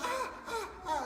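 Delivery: high-pitched mock wailing; short high wails like an upset child; imitated crying. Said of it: "and started crying".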